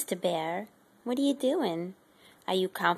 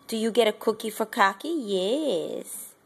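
ordinary delivery

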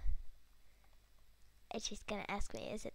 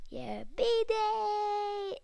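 high, loud birthday cheer; a gamer shrieking with joy over a birthday; excited cry; 9 semitones higher, 7 dB louder than usual. Real, not acted. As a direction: squeal it with delight.

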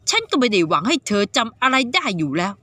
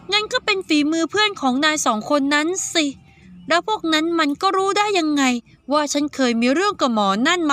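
put-on higher young girl's voice, puzzled; 5 semitones higher than usual. Saiyaka questioning the boy, in a higher girl's voice. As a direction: voice Saiyaka as a suspicious teenage girl. Girl character reasoning aloud.

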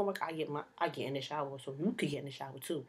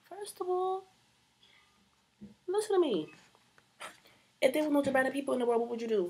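sassy; 8 semitones higher, 5 dB louder than usual. Acted. Mock confronting critics.

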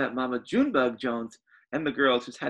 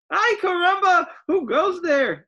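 excited Skippy John Jones; 7 dB louder than usual. Acted.

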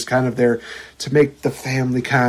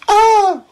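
high, loud mock shriek; mock scream; 5 dB louder than usual. Mocking the sister's fear of spiders with a high loud shriek. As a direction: fake a startled shriek at a spider.

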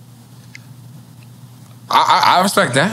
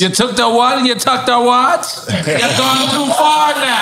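put-on Jamaican accent; 10 semitones higher than usual. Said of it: "Jamaican accent keep coming out".

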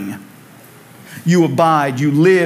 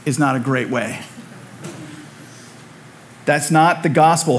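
ordinary talk

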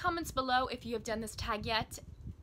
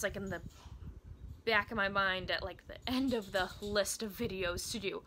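ordinary talk, nothing marked

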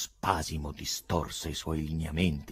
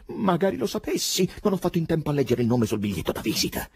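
high lament, then rushing on; afflicted cry; 10 semitones higher, 4 dB louder than usual. A narrator's reading. Poet regretting he missed the name, afflicted. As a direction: wail it, then hurry the explanation. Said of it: "gridò afflitto Ivan".

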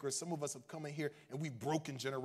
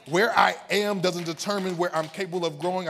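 loud, raised preaching declaration; not somber; 6 semitones higher, 15 dB louder than usual. Real, not acted. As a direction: drive it out to rouse the congregation. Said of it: "we're not just gonna be somber".

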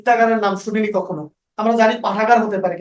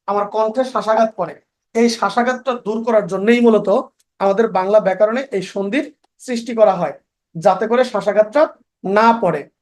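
ordinary talk

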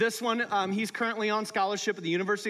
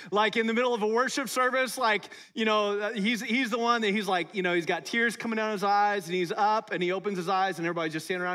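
ordinary talk, nothing marked

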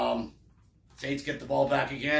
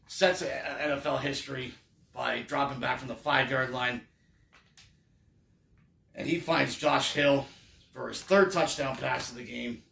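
ordinary talk

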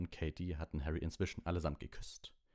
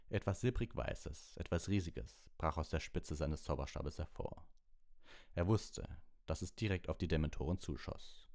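ordinary delivery

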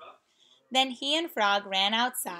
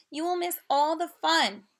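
pleading cry; 6 semitones higher than usual. Acted. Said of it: "cried Frog".

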